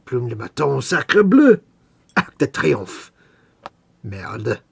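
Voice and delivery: hammed-up French accent; mock French chef voice, hamming it up. Mock French phrases after French talk.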